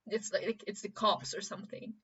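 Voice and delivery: normal talking voice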